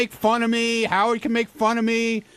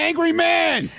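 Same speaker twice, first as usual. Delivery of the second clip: mocking shout; 7 semitones higher, slower than usual. Real, not acted. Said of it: "mocking Artie".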